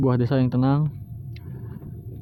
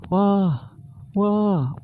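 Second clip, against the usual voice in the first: startled wah; 7 semitones higher than usual. Real, not acted. Narrator reacts to knife stab.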